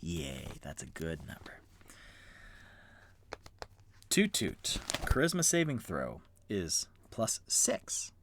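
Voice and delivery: ordinary talk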